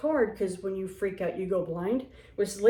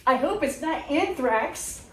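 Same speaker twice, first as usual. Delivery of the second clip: coworker's quip; 5 semitones higher, slower than usual. Retold or imitated. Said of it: "Diane next to me going".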